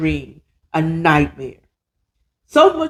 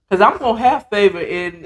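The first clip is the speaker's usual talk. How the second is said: ordinary talk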